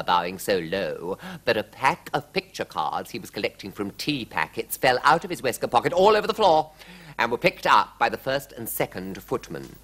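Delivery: ordinary delivery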